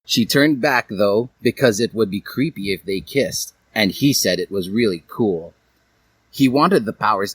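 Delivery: usual speaking voice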